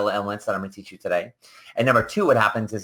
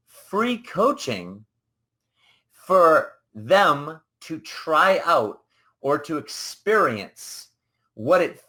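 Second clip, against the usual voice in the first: slow emphasis; 3 semitones higher, slower than usual. Real, not acted. Slow emphatic statement of philosophy.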